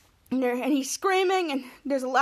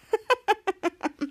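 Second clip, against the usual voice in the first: high-pitched staccato laugh; laughter; 7 semitones higher than usual. Acted. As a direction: laugh out in a run of quick pulses. Laughs at the episode's events.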